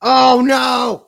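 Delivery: loud, high drawn-out cry; big high-pitched outburst; dismayed shout. Real, not acted. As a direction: wail it in comic dismay. Reacting to viewer's comment on screen.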